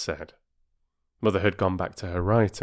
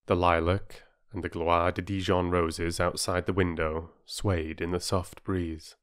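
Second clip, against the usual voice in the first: plain reading voice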